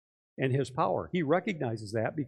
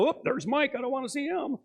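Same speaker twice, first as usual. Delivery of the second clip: mock inner voice; 9 semitones higher than usual. Imagined thought in store aisle.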